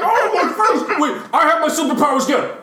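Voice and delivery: Superman. Retold or imitated. Friend mocking the fight scene, high comic wail. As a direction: squeal it like a hammed-up parody. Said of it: "Superman's like".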